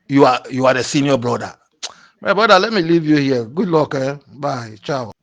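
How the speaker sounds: usual speaking voice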